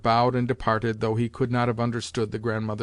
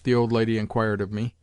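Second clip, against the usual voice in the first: ordinary talk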